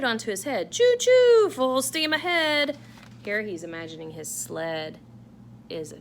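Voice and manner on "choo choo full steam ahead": very loud, much higher choo-choo; train whistle call. Acted. Santa playing conductor to his sled, loud and high.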